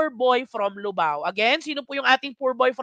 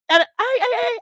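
excited ay; 11 semitones higher, slower than usual. Real, not acted. Host sees answers come in.

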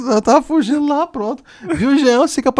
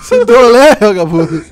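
indignant shout; 8 dB louder than usual. Real, not acted. Outraged reaction.

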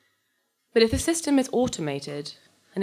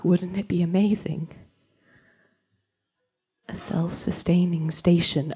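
awed whisper. Acted. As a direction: breathe it like a wonder. Dreaming of the space commune.

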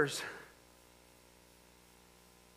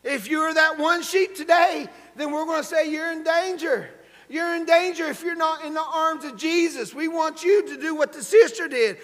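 raised, higher altar-call voice; urgent preaching; 6 semitones higher, 4 dB louder than usual. Real, not acted. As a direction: press the appeal on the congregation.

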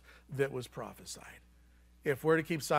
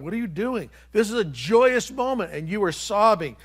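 higher, louder mock astonishment; imitated incredulous disciples; 6 semitones higher, 7 dB louder than usual. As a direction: voice baffled disciples challenging him. Disciples confronting sobbing Jesus.

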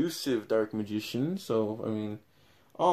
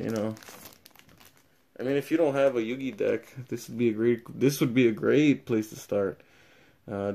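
ordinary delivery

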